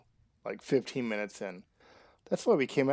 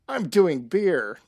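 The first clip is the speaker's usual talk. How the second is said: loud declaration; 5 semitones higher, 9 dB louder, slower than usual. Real, not acted. Repeats drink choice loudly.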